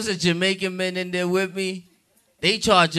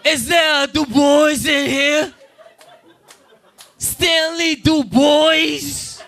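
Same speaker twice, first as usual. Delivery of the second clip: high, hammed-up camp call; flamboyant doctor; 7 semitones higher, 4 dB louder, slower than usual. Flamboyant doctor calling a patient in the hospital, flamboyant. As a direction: sing out the name high, stretching the end. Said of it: "real flamboyant".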